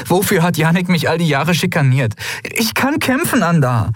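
high-pitched indignant plea; upset, insistent objection; pleading protest. A narrator's reading. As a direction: argue back urgently, voice pitched high. Endriel protests being sent away.

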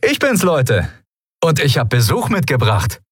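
high-pitched jolly announcement; cheerful call. A narrator's reading. Sephiron to his pals, bringing visitors, cheerfully calling out. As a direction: greet the gang in the best of moods. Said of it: "rief Sephiron seinen Kumpanen in bester Laune zu".

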